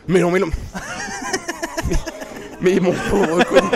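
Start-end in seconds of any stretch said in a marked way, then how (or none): 0.5-2.5 squeaky burst of laughter; high laugh. Real, not acted. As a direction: break into a high giggle. Laughing at colleague.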